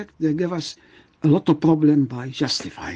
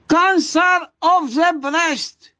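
urgent shout; 11 semitones higher, slower than usual. Real, not acted. Emphatic lecture warning.

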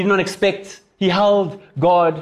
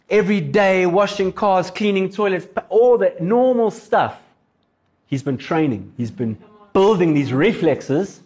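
ordinary talk, nothing marked

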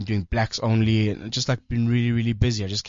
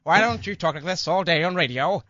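a hint of an old-time radio announcer, higher; 1950s radio voice; 6 semitones higher than usual. Host showing the guest a mild fifties announcer voice. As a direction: lightly mimic a fifties broadcaster. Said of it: "they talked a little like this".

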